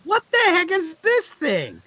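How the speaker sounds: high, slow baffled question; puzzled disbelief. Retold or imitated. Reacting to hearing album.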